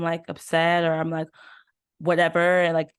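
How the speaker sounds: ordinary delivery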